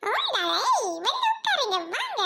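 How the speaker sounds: high-pitched put-on invitation; eager calling. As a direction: call her over, high and eager. Calls newcomer to sit. (உச்சக் குரலில் அழைப்பு)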